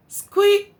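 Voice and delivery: very high loud mouse squeak; tiny squeak. Acted. Mouse squeaks back at Duck, very high and loud.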